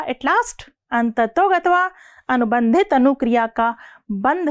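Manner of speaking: slow, deliberate teacher reading with long pauses; said slowly. Shown as a demonstration. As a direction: spell the definition out chunk by chunk so students can follow. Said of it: "अब मैं यही line धीरे बोलूंगी".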